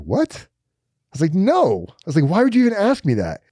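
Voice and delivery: high, rising indignant what; shocked disbelief. Retold or imitated. Inmate asked about kids, he answers high and shocked.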